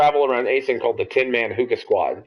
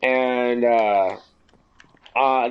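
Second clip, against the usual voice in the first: ordinary talk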